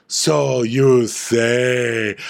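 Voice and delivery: very slow, drawn-out disdain; Diana. Acted. Diana doubting Sutton, slow theatrical disdain. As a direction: stretch every word dismissively. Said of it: "I'll be Diana".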